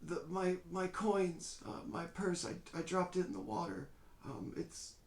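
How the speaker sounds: put-on high, halting frail old man. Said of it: "this kind of frail voice".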